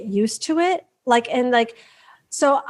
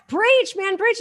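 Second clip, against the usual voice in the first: mock cheering; 7 semitones higher, slower than usual. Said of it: "I'm like".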